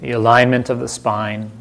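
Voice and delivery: unhurried meditation voice with long silences between phrases; calm, spacious delivery, leaving room to breathe; guided meditation. Acted. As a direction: gently guide listeners inward, phrase by phrase.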